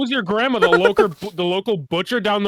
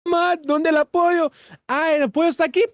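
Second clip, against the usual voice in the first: mock Spanish accent; 9 semitones higher than usual. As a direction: ham up a Spanish mom call.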